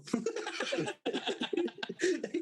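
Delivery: real laughter; high laugh. Real, not acted. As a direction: burst out laughing. Laughing at the post story.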